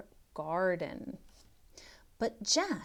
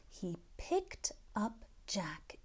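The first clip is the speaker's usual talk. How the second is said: suspense whisper; 10 dB quieter than usual. Acted.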